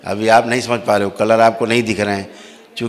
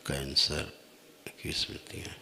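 hushed trance voice; 3 semitones lower, 21 dB quieter than usual. Acted. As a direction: murmur each ailment as if sensing it. Guru divining the visitor's ailments.

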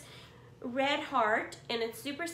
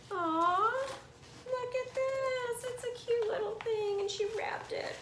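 much higher, touched cooing over something cute; delighted cooing; 10 semitones higher than usual. Real, not acted. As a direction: coo like you just saw a puppy. Cooing over a wrapped present.

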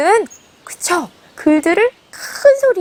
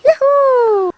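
high whoop of joy; a happy hare's yay; cheer; 8 semitones higher, 3 dB louder, much slower than usual. Acted. 토끼가 신나서 외치는 환호.

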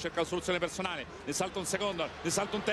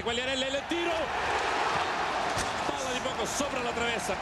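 excited commentary; 5 semitones higher than usual. Real, not acted. Shot over the bar.

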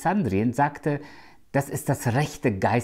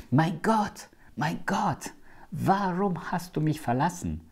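higher, straining cry of abandonment; anguished cry; 5 semitones higher, 4 dB quieter, slower than usual. Acted. Preacher voicing Jesus crying out on the cross, anguished. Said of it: "als Jesus am Kreuz von Golgatha schrie".

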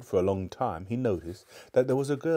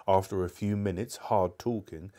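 ordinary delivery, nothing marked

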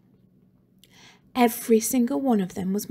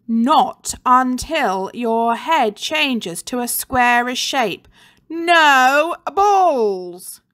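stern teacher; 4 semitones higher, 5 dB louder, much slower than usual. A narrator's reading. Teacher refuses the trapped boy.

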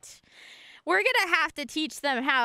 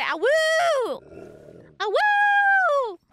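high yelping howl, louder; howling like a wolf pup; 11 semitones higher, 4 dB louder, much slower than usual. Pretend. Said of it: "Linker Poo say".

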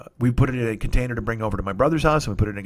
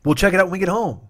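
pitched way up, rushing to reassure; frantic soothing; 8 semitones higher than usual. Retold or imitated. Calming his upset friend, high and frantic. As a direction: talk her down in a high panic. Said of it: "I'm like".

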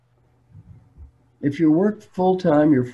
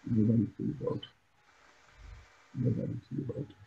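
hushed, calming reminder; quiet self-talk; 2 semitones lower, 11 dB quieter, faster than usual. Acted. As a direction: say it privately to yourself. Models quiet words to tell yourself.

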